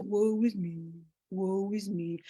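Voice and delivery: low, soft, mopey donkey moan; gloomy Eeyore. Retold or imitated. Eeyore moping to his friends, low and soft. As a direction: droop every word like Eeyore sighing at life. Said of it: "Eeyore was always like".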